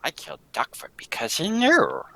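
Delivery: hushed deadly admission; whisper. Acted. Villain whispering she killed Duckfoot to Tess. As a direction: let the murder slip out in a whisper.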